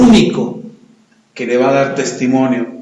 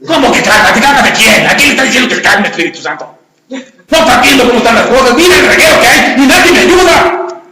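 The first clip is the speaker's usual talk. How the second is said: much louder, higher frantic yelling; angry outburst; 8 semitones higher, 7 dB louder than usual. Acted. Frustrated mother yelling at the Holy Spirit about the mess, loud and high. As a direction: rant like an overwhelmed mother.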